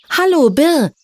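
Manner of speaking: high, sing-out greeting; calling up. A narrator's reading. Little Silja hails the snake on the branch, calling up. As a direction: call brightly up to someone on a branch. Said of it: "rief die kleine Silja zum Ast hinauf".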